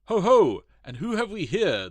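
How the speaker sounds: loud, high booming greeting; an old colonel booming hello to the children; jovial colonel. Acted. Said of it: "cried in a jovial manner".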